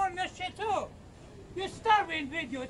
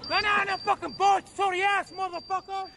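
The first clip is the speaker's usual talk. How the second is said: loud yelling; 2 semitones higher, 7 dB louder than usual. Real, not acted. Man yelling insults.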